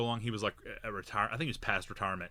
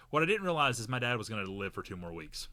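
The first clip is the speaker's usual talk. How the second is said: normal talking voice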